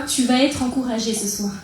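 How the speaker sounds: ordinary talk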